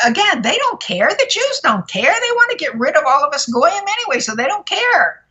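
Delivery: high, swooping exasperation; exasperated insistence. Real, not acted. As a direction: pitch leaps up on each emphatic phrase. Ranting about others not caring.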